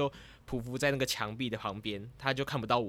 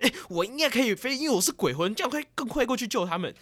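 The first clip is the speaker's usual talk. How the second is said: excited thought; 11 semitones higher, 7 dB louder than usual. Retold or imitated. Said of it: "就觉得".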